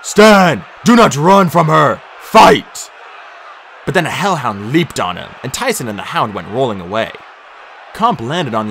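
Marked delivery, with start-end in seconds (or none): 0.0-2.9 loud, high rallying cry; shouted order to fighters; battle shout. A narrator's reading. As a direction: bellow commands across a battlefield.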